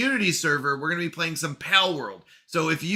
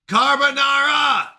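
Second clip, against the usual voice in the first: high, loud, theatrical cry; emphatic proclamation; 8 semitones higher, 5 dB louder than usual. Pretend. Streamer echoing a pronunciation clip for chat, exaggerated proclamation. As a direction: sing out the word with gusto.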